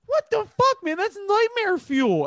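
appalled cry, stretching the final words; appalled disbelief. Real, not acted. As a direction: exclaim in horrified shock, voice shooting up. Reacting to dog walker shooting story.

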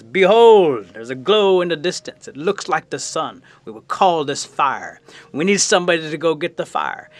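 proclaiming bear leader. Acted. Bear calling the animal council to fetch fire, proclaiming. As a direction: address the council with ceremony.

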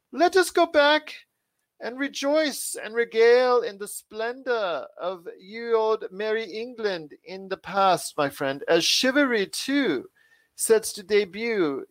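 high, slow, hammed-up old English accent; worst English accent. Host hamming up an old English accent to his guest. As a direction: ham up a bad merry old English accent. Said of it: "in the world's worst English accent that I did".

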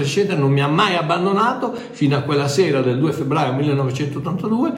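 ordinary talk

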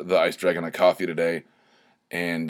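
usual speaking voice

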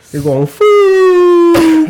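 long high drawn-out wail; falling wail. Pretend. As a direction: wail it like something plunging down. Plane crash sound effect.

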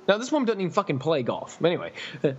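ordinary talk

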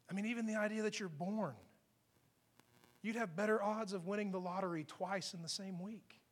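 very soft, near-whispered questioning; gentle reassurance. Acted. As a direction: coax them quietly, without blame. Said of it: "What I see is".

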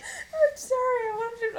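high squeaky mock whine; hammed-up whiny plea. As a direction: whine it like a pleading kid. Mock whining over Nick Cage.

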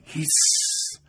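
sharp unvoiced hiss to silence; loud hush. A narrator's reading. The crowd hushes the arriving husband, a sharp hiss. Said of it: "همه فریاد کردند".

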